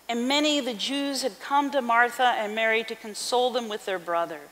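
loud, fast, formal Bible reading; scripture reading. Acted. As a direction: proclaim the passage to the congregation. Reads scripture aloud.

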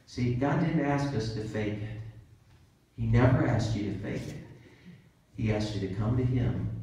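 ordinary delivery

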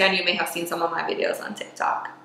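unvoiced whisper, a quick secret aside. Real, not acted. To viewers about her TikTok, a soft whisper.

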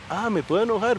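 a high mock surprise; sorpresa imitada. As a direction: sound pleasantly caught off guard. Padre retratado reaccionando, sorprendido. (sorpresa fingida, más aguda)

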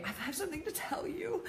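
high, soft, retold tearful confession; mock tearful. Friend confessing at a bar, mock tearful. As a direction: act out a teary, hesitant admission. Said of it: "and I'm crying".

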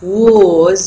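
WAS in its strong form, loud, long full vowel. Shown as a demonstration. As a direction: stress "was" hard with a long, open rounded vowel. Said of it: "هذا السترونج فورم".

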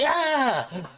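high-pitched mock startled scream; acted cry of shock; startled yelp. Driver's startled reaction acted out.

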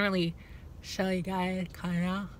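talking with mouth held open; lip pulled down by fingers. Real, not acted. Seen 0: fingers hook lower lip down, mouth held open showing teeth.

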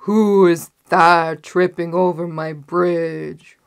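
loud, slow troll roar. A narrator's reading. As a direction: bellow the challenge like a troll. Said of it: "roared the troll again".

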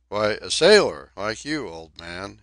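mock-hearty, cheeky reply; hearty but impudent. Donkin answering the crew, hearty yet impudent. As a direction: try for hearty and land on impudent. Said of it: "in a tone that meant to be hearty, but was impudent".

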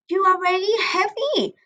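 lightly acted moan at a friend; mild complaint. A narrator's reading. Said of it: "Dola complains".

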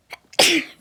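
loud sneeze; sneezing. Real, not acted. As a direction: another sudden sneeze. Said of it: "Video başında hapşuracağım ya". (hapşırma)

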